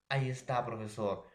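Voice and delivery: ordinary talk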